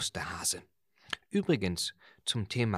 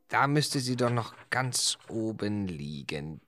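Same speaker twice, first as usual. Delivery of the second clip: normal talking voice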